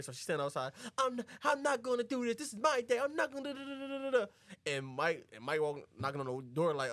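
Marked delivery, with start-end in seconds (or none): 1.0-4.3 high-pitched refusal; imitated upset bride. Bride refuses on her day, high mock upset.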